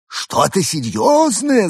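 high, slow anxious alien; drawn-out alien concern; worried alien. A narrator's reading. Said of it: "забеспокоился Евгений". (встревоженный высокий голос пришельца)

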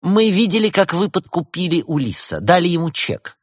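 high, pointed actress's accusation; theatrical prosecutor; accuser's role. A narrator's reading. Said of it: "эту роль тут же приняла на себя актриса".

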